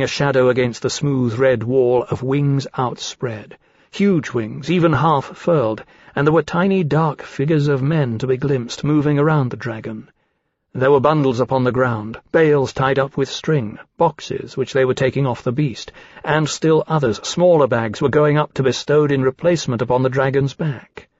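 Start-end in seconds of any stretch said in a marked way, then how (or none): none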